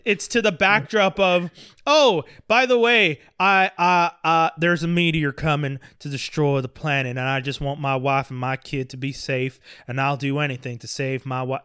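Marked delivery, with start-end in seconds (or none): 1.9-11.6 exaggerated Southern country accent, lower than usual; mock country accent. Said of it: "I'm doing this accent".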